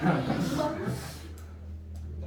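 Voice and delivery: high, airy chuckle; amused laugh. Real, not acted. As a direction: laugh softly after teasing a student. Teasing a drowsy student. (재미있어하는 웃음)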